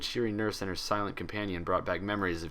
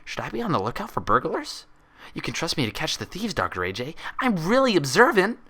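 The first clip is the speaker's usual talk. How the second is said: eager Pinkie; 8 semitones higher, 5 dB louder than usual. Acted. Pinkie voiced in story.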